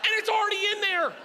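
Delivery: high-pitched indignant self-defence; exasperated comic rant. Acted. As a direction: protest at a high pitch, hammed-up. Defends himself against audience judgment.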